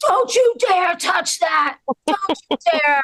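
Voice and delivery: outraged shout. Acted. Irish fans defending their mascot, hammed-up furious shout. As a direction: bellow it like a furious fan. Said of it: "And the Irish were like".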